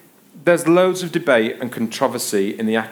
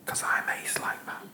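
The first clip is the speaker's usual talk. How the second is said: cheeky whispered aside; hushed, voiceless self-congratulation; whispered boast; 11 dB quieter than usual. Real, not acted. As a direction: whisper it as a sly little brag.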